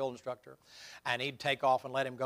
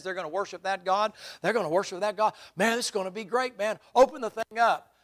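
higher pitched, eager and fast, a servant bursting with hope; mock excited servant; 5 semitones higher, 5 dB louder than usual. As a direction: rush him to open the letter. Servant excited over king's letter.

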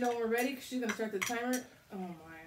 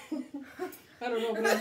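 laughing through the words; breaking into laughter mid-sentence; 6 dB louder, faster than usual. Real, not acted. Laughing while eating spicy food.

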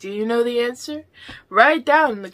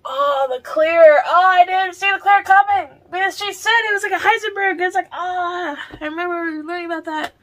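high, loud excited gush; 9 semitones higher, 9 dB louder than usual. Real, not acted. Recapping the mystery game's twist to viewers, excited and high. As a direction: burst out with thrilled realisation.

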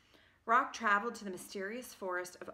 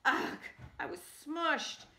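defeated apricot; 7 semitones higher, slower than usual. Acted.

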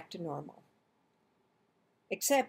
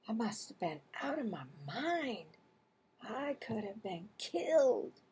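hushed thought; 7 dB quieter than usual. A narrator's reading. Marvin's hushed inner thought.

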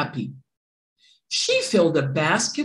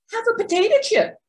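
very high and quick chirp; eager first pig offering snacks; fast high pig voice; 4 dB louder, much faster than usual. A narrator's reading. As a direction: rattle it off like an eager piglet.

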